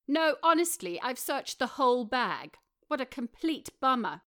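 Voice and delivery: insist, overdoing the disappointment; protesting Rory. A narrator's reading.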